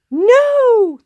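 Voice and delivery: high, loud refusal; crowd yell. Acted. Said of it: "the crowd yelled".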